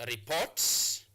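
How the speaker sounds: "report" as re-PORT, rising at the end; teacher's demo of re-PORT; stress on second syllable. Shown as a demonstration. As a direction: hit the last syllable of "report". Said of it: "you place your stress mark on the second syllable".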